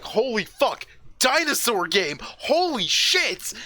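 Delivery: high-pitched, thrilled outburst, drawn out at the end; hyped excitement. Retold or imitated. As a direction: relive a burst of fan excitement. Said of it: "the part that I got excited for".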